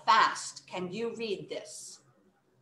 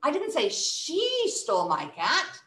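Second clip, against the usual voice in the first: "she" lifted and held; higher pitch, leaning on "she"; single out "she" as the wrong person; stress on SHE; 4 semitones higher than usual. Shown as a demonstration. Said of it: "Now it's talking about who".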